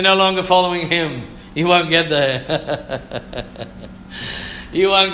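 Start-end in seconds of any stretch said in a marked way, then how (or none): 1.5-3.0 slow, lower, with a laugh in it. Acted. Preacher to congregation about leaving the road, laughing. 3.1-3.6 short laugh. Real, not acted. Laughs after warning.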